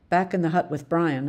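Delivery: usual speaking voice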